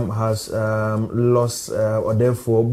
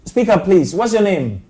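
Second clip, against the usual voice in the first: higher, raised voice to a faint caller; calling to caller; 7 semitones higher, 4 dB louder than usual. Real, not acted. Host asks a faint caller to speak up, calling out. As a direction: project down a bad phone line.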